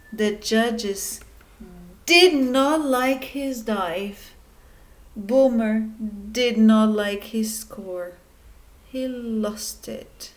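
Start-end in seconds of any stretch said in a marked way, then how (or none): none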